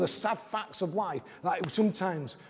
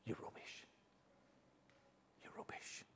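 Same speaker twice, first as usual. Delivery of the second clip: unvoiced whisper in your ear; devil's whisper; 27 dB quieter than usual. Preacher voices the enemy whispering lies, whispered. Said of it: "when I whispered".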